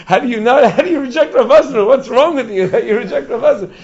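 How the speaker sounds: high, loud outraged questioning; mock incredulity. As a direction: scold in disbelief, half-laughing. Imagined scolding of rejecting admissions tester.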